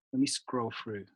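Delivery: usual speaking voice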